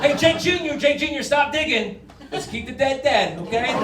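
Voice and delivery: put-on calling to kid. Calling to his son.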